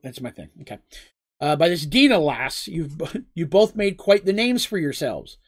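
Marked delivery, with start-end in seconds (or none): none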